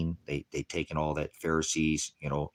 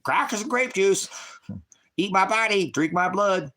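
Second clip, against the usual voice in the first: much higher and louder squawking parrot; 6 dB louder than usual. Pretend. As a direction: screech it like a mocking parrot. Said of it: "mirroring like a parrot".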